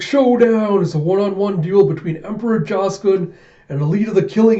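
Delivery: high, loud fight-announcer hype; arena announcer. Acted. Announcer introducing a one-on-one duel, high loud hype. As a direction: proclaim the duel to the crowd.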